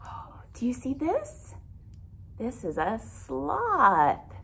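ordinary delivery

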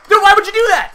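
high, loud outraged cry; dude, why, a high disbelieving shout; outraged yell. Real, not acted. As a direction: yell at your teammate in disbelief. Teammate kills him, outraged.

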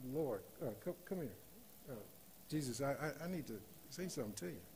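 hesitant, hushed acted Peter; discreet aside. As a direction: pull him aside quietly, stumbling. Acts out Peter taking Jesus aside.

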